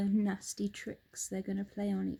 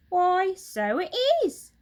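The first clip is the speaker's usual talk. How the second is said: Missus Twit; 10 semitones higher, 9 dB louder than usual. Acted. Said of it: "Missus Twit said".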